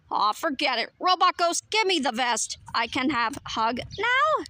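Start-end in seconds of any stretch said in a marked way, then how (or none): none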